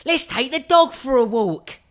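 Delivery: high squeaky little skeleton. Acted. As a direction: chirp it like a tiny skeleton. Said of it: "said the little skeleton".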